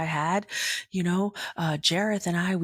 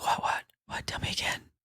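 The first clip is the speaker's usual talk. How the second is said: hushed, stunned whisper asking again; incredulous whisper; 5 dB quieter, faster than usual. Retold or imitated. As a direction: whisper in disbelief. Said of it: "I was like".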